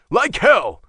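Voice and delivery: high, loud outburst; sudden high-pitched burst of defiance; angry defiance. Acted. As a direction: spit out a loud, defiant refusal. Angry defiance before tearing off lock.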